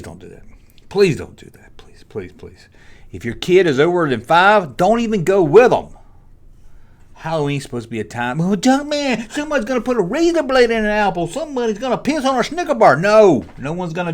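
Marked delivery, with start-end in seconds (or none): 0.0-2.7 breathy pleading please, please, please in a hush; whispered plea. Real, not acted. Begging parents in a whisper to skip trunk-or-treat. 8.4-13.0 mocking worrier. Mocking paranoid Halloween worriers.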